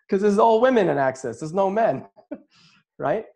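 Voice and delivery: high, laughing aside. Real, not acted. Speaker joking about Access to his audience, laughing.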